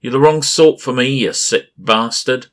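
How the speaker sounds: high, loud furious shout; defiant shout. A narrator's reading. Lees shouts defiance at the creature holding her. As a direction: scream defiance at your captor. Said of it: "I heard her shout".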